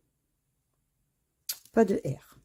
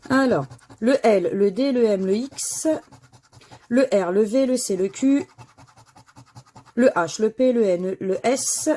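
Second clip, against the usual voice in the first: loud, steady letter call like a bingo caller; loud draw announcer; 3 semitones higher, 7 dB louder than usual. Acted. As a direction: announce each letter clearly to the viewers. Calling out the drawn letters.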